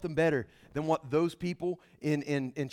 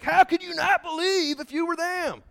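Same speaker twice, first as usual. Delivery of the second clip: much higher, exasperated question; incredulous; 11 semitones higher, 3 dB louder than usual. Retold or imitated. Believers today scoffing at people of old, high and incredulous. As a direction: ask it in mock disbelief at the ancients. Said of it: "And we're like".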